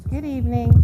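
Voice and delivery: usual speaking voice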